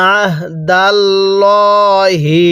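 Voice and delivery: slow drawn-out tajweed recitation; Quranic recitation. Shown as a demonstration. Teacher leading students to repeat, slow recitation. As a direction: stretch each syllable for the class to repeat. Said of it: "এরপরে বলুন".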